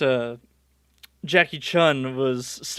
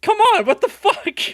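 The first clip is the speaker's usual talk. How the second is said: high, loud mock exasperation; accusatory; 5 dB louder than usual. Jackie blaming Goku for transforming, accusatory. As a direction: blurt it as an outraged accusation. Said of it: "It's very accusatory. He's just like".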